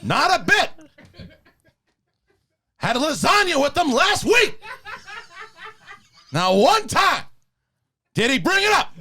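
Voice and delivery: Pacino rant. Acted. Pacino rant bit continues.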